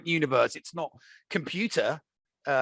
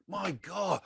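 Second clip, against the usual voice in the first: ordinary talk, nothing marked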